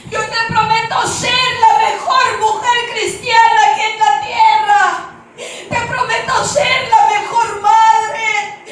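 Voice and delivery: high, loud, desperate bargaining with God; shouted pleading. Retold or imitated. Woman promising God to be better, shouted plea. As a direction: beg at full voice, stretching the last words. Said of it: "yo empiezo a gritarle".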